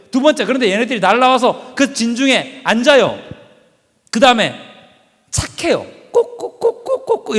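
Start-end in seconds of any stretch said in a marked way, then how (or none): 6.1-7.4 high, slow imitated clucking; chicken clucking. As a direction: cluck like a calm hen. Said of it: "이러고 있어요".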